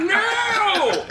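high, loud cry of horror; shouted no. Retold or imitated. Narrator reliving the moment he saw, shouting no. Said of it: "I'm like".

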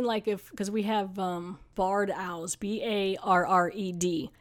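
ordinary talk

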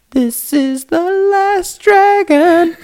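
very high, drawn-out dragon proclamation; hammed-up quote. Man joking about the Irish dragon, hammed-up high proclamation. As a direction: stretch every word high and slow.

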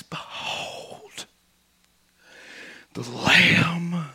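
hushed whisper, slow and soft; awed whisper. Acted. Preacher whispering the angel's announcement, reverent whisper. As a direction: whisper it as a holy secret.

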